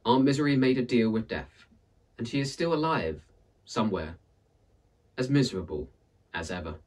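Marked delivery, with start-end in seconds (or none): none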